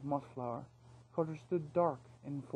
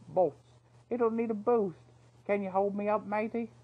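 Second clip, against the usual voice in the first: higher, louder chirpy thief; eager rascal asking for a lift; excited cheeky thief; 5 semitones higher, 6 dB louder than usual. A narrator's reading. Knopf asks for a boost.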